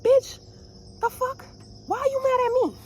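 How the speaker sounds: high, loud outraged shout; shrieking indignant comeback; furious retort. Retold or imitated. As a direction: scream back at the driver. Cursed at, retorting.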